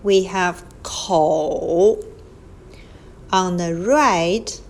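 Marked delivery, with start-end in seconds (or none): 0.8-2.0 口 said slowly as kou, dipping third tone; model Chinese word. Shown as a demonstration. Teacher naming the left radical, slow model. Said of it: "On the left, we have".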